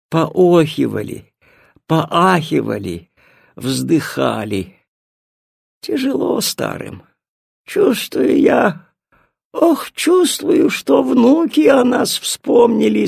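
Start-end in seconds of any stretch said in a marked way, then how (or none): 5.8-13.1 put-on high, slow old-woman voice; old grandmother. A narrator's reading. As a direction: sigh it slowly like an elderly granny. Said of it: "сказала бабушка". (старческий голос бабушки)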